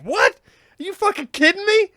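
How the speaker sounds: high, loud, stunned outburst; shocked disbelief. Retold or imitated. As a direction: blurt it out in disbelief. Hearing about the grizzly.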